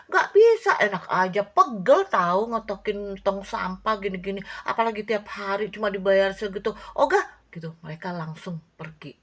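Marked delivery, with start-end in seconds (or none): 0.1-7.5 flatly refuse, insulted by the pay; indignant refusal. Acted. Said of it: "yang satunya gini".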